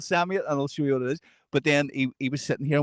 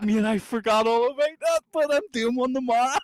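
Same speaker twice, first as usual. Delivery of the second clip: higher, giggly banter; amused joking; 9 semitones higher than usual. Retold or imitated. Joking back to Maxwell, amused. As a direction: tease him, barely holding in a laugh.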